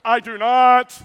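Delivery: big, high mock shout; exaggerated loud, drawn-out refusal; mimicked crowd protest. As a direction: belt it out as an indignant crowd's denial. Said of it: "Everybody's going".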